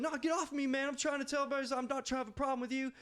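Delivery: high-pitched, rushed mock pleading; hammed-up indignant outburst; imitated agitated protest. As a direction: protest fast and high, fending someone off. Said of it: "this dude starts screaming".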